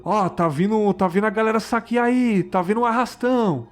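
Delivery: high, urgent mock yell at normal volume; imitated warning shouts. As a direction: imitate people crying out a street warning. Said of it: "Eu lembro da galera gritando".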